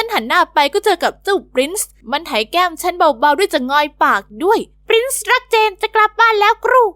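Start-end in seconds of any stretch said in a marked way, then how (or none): 4.7-6.8 talking parrot. Acted. The parrot Prince coos to Jen in a high squawk. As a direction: chirp it like a fond pet bird.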